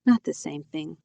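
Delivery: understated disgusted dismissal. A narrator's reading. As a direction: wave it away with mild distaste. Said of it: "she says in disgusted tones".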